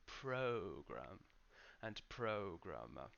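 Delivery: program and programmer stressed on PRO; first-syllable stress. Shown as a demonstration. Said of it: "give a little time for that syllable".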